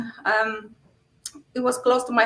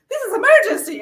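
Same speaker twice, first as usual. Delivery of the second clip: much higher and louder, emphatic; exasperated insistence; 11 semitones higher, 6 dB louder, faster than usual. Retold or imitated. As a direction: insist it is an emergency. Exasperated reply to the emergency line.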